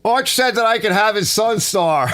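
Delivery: playful shout at the door; mock shout. Imagining Big Mike at the door, playful mock shout. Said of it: "He'll be knocking on the door going".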